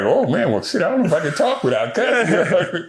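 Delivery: high, laughing admission; laughing confession. Retold or imitated. Telling the film crew he can't stop cussing, laughing and high. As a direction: laugh through it, caught out.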